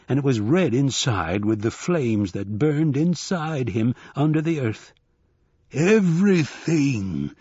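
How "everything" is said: slow, huge, higher buffalo roar. A narrator's reading. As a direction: roar it out of the fire. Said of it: "roared the buffalo man".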